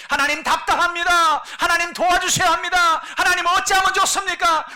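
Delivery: very high, loud crying plea; tearful desperate prayer. Retold or imitated. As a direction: cry out to God in distress. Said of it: "우리가 눈물로 기도할 때". (아주 높고 크게 울부짖는 기도)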